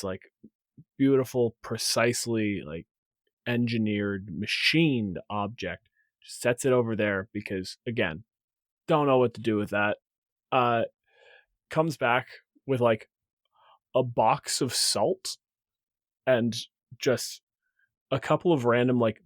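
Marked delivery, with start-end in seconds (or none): none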